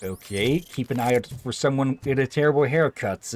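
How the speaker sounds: ordinary delivery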